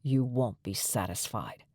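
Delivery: a low, slow, quiet male reply; low slow man voice. A narrator's reading. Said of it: "Miles's voice added".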